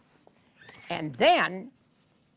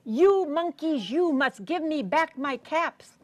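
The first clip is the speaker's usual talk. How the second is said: higher, stretched storybook scolding; indignant mock demand, drawing out YOU; quite angry peddler; 6 semitones higher than usual. A narrator's reading. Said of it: "now he was quite angry".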